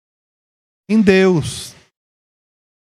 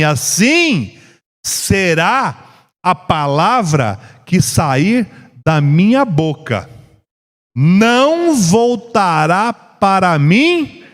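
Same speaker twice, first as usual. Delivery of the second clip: very slow, emphatic declamation; drawn-out, deliberate pulpit delivery; solemn scripture proclamation; much slower than usual. Acted. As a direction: proclaim it phrase by phrase, with weighty pauses. Quotes scripture aloud.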